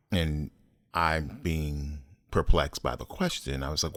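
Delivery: ordinary delivery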